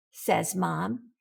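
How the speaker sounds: usual speaking voice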